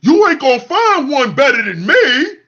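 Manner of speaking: mocking men boasting no one beats them; mocking bitter man. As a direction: wail it like a jilted man. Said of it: "You sound like a woman".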